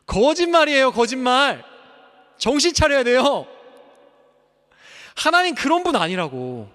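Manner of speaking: shouted preaching. Acted. Pastor rejecting Satan's lie, shouting. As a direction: thunder it out from the pulpit.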